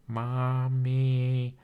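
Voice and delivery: lightly voiced baby robot calling out; quavering baby voice. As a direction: call out for mommy like a little lost robot. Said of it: "in a quavering, high-pitched metallic voice".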